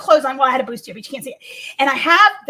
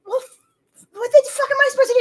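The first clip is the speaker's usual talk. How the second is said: exasperated self-quote; 10 semitones higher than usual. Retold or imitated. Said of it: "I'm like".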